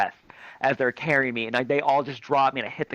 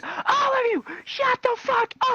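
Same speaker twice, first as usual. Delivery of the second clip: high, stretched mock shriek; freaked-out nurse; slower than usual. Nurse silencing the kids carrying him, shrill freak-out. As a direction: screech the order at all of them. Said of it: "thinks I am dying and freaks out".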